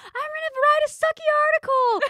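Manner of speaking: very high, loud mock triumph; cheering. Movie heroine rallying as everyone cheers, mock elated. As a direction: squeal it like a hammed-up victory cry. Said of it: "they're all cheering".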